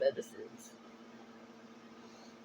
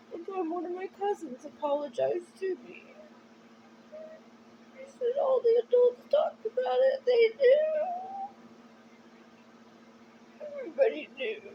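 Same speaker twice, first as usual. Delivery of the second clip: crying; 6 dB louder than usual. Real, not acted. Telling viewers her family knew, crying. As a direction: voice cracking high and loud through tears. Tearful confession about family.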